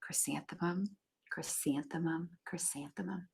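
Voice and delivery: softly whispering her own name; hushed, dreamy whisper. Acted. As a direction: breathe the name to yourself in the mirror. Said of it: "whispered it to herself".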